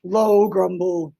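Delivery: deep, slow grumbling voice; low grumble. Shown as a demonstration. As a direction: drag it out low like a growl. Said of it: "give you me a l-, one low grumble".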